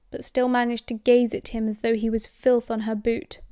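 plain reading voice

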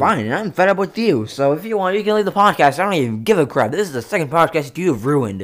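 loud, high furious yelling; furious shout. Acted. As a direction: scream him out of the podcast. Full shouting match.

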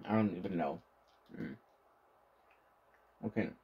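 talking between chews; muffled chewing speech; mouth full while chewing. Real, not acted. Seen 2: Cheeks full and lips closed, still chewing since earlier frames.

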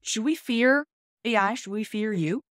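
usual speaking voice

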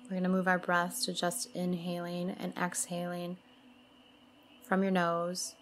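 soothing mantra-and-breath guidance, spaciously phrased; guided meditation. Acted. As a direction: lead them gently through the breathing, unhurried.

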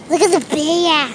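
ordinary talk

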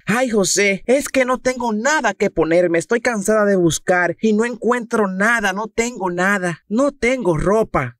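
high complaining woman's voice; whiny wife. Acted. Esposa se queja con el marido. (esposa quejumbrosa con voz mucho más aguda)

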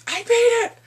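loud, high-pitched squeal; hammed-up excited outburst; mock excited yell.

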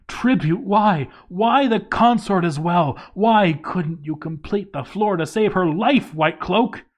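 high, loud mock-anguished wail at the volcano; hammed-up grief, shouting at the heavens; anguished cry. Gamer mourning his dead countess, loud mock anguish.

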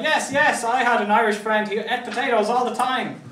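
mock keen, jumping in to join the joke; playfully overeager foreigner chiming in; eager joining in. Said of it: "So you decide".

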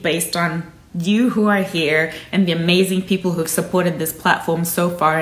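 usual speaking voice

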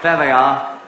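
normal talking voice

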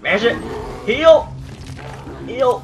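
high frantic shouts; yelling at a bear. Real, not acted. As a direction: bark urgent commands mid-fight. Fighting the bear.

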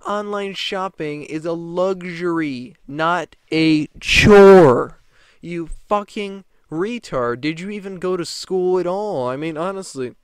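shouting mock rant; angry rant. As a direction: blast her, stretching out chore. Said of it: "what I was thinking".

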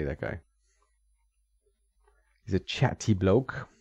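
ordinary talk, nothing marked

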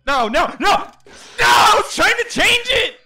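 panicked yell. Real, not acted. Panicking as game fails.

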